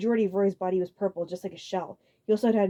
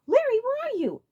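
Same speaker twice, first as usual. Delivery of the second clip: Iggy calling out; 6 dB louder than usual. A narrator's reading.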